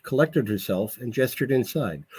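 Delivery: ordinary delivery, nothing marked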